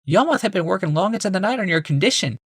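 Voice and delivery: a polite wife, lifted and high; Rebecca. A narrator's reading. Wife greeting her husband, high woman's voice.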